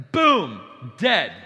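two loud, punchy single-word blows; sudden loud strike. Acted. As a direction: hit each word like an instant death sentence. Imitates instant divine striking down.

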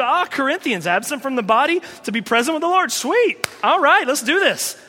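high, loud, cheerful eagerness; eager Paul. Retold or imitated. Paul answering his persecutors, gleeful and high. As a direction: welcome death with glee. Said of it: "he would be".

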